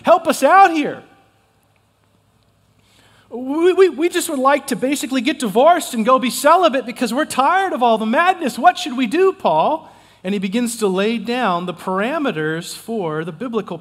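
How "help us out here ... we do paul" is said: higher, louder mock plea; a hint of hammed-up desperate couple; lightly voiced pleading Corinthians. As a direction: play the desperate couple begging Paul. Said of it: "they're going".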